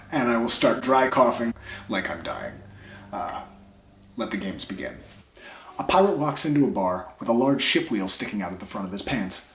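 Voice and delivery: ordinary talk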